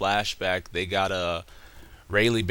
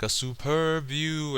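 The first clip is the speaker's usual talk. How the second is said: high, drawn-out mock catchphrase; Franky imitation; 7 semitones higher, 4 dB quieter, slower than usual. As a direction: stretch it out slowly, pitched up. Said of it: "you know how Frankie is".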